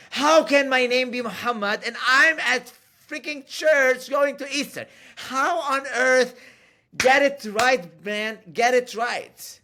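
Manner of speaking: high, loud exasperated outburst; retold pissed-off rant. Churchgoer fed up with a stranger's name guesses, retold angry rant. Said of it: "I got so pissed".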